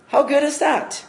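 high, delighted question to the crowd. Real, not acted. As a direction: lift it up in joy.

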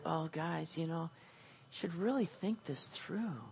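hushed, overly mild plea; gentle soft coaxing. Retold or imitated. Paul imagined gently urging the Galatians, hushed and mild. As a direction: coax them softly, the way Paul would not. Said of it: "he's not trying to be gentle".

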